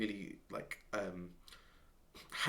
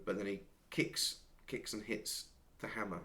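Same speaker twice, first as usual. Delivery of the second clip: ordinary talk, nothing marked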